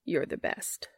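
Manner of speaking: soft whispered thanks. A narrator's reading. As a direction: breathe it close to her ear. Whispered, unvoiced after hug.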